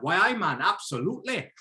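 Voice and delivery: put-on Geordie accent. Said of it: "And then he's like".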